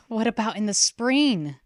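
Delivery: worried wondering. A narrator's reading. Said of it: "she wondered".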